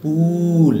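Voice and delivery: "পুল" with a long, held oo; long u sound. Shown as a demonstration. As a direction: stretch the vowel in "পুল". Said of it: "long".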